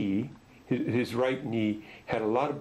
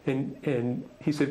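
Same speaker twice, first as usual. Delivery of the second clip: ordinary talk, nothing marked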